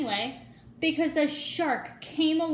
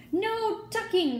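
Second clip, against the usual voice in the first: much higher, louder, slow protest; crew crying out; 9 semitones higher, 4 dB louder, slower than usual. A narrator's reading. Pirate crew refusing to tuck in the boy, loud slow cry. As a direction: refuse it all together, drawn out. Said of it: "the crew cried".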